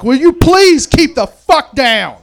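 loud, slow yell at the crowd. Retold or imitated. Sick neighbor yelling at the party, loud and slow. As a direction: bellow it across the yard. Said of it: "I just yelled out to the crowd".

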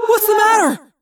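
high, loud alarmed cry. Acted. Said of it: "Tobias cried".

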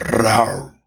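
low rolled lion roar; huge fiery dragon roar. Acted. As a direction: roll out a lazy lion roar.

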